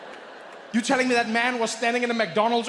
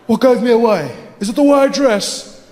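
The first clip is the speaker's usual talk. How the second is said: amused spy; 10 dB louder than usual. Acted. Acted Bond banter, laughing.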